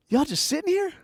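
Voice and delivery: high, lilting imitation; put-on woman's voice. Stranger questioning men in a van, imitated woman. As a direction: pitch it way up, prying.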